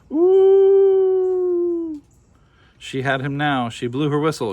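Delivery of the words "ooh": long, high, loud howl; wolf howl. Acted. Wolf howling to catch the shepherd's attention, loud high howl. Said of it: "so he howled".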